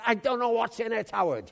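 loud, high-pitched comeback to a friend; exasperated retort. Retold or imitated. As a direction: burst out in mock exasperation. Acted angry retort to friend.

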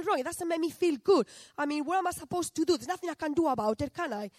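loud, high, fast frustrated outburst; upset complaint to God. Retold or imitated. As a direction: vent your frustration at God, raising your voice. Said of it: "And I said to God".

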